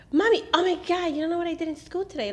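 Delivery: put-on high, fast, gushing child voice; little daughter breathless to tell her school day, high and fast; excited little girl. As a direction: rattle it off like a kid bursting with news. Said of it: "She's like".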